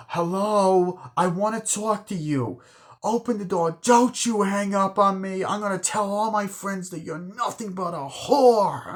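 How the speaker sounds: high-pitched acted tirade; angry confrontation. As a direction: act out a jealous, threatening outburst.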